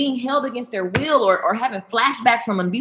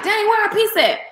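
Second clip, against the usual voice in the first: mock exasperated; 12 semitones higher than usual. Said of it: "we like".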